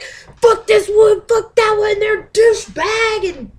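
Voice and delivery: high, loud, slow mock fury; mock angry rant. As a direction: ham up a furious poster. Mocking angry people's rant.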